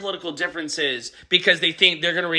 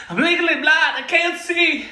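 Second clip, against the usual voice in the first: Rams long snapper impression; 10 semitones higher than usual. Joking about the Rams long snapper missing the block, high dopey voice. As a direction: play the clueless long snapper whining that he can't see. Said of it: "This is my impression of the Rams long snapper".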